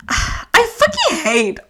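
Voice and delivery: slow, exasperated start of a rant; sigh, then draw out the disgust. Real, not acted. Reader cringing at his line, ranting. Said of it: "I'm gonna go on a rant".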